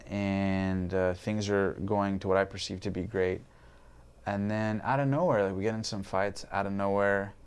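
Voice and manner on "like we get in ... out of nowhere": ordinary talk, nothing marked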